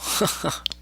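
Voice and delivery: breathy chuckle; a quick high chuckle; short amused laugh. Real, not acted. Vlogger finding troll's profile empty, short amused laugh.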